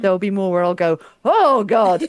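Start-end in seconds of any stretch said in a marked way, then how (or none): 1.2-2.0 loud mock outburst, pitched up; all-caps exclamation. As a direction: blurt it like a message typed in capitals. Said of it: "all caps at you in Discord".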